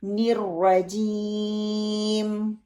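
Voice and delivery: slow نرجیم, lips closed on m; model recitation. Shown as a demonstration. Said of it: "picture میں نظر آ رہا ہوگا". (نمونہ تلاوت)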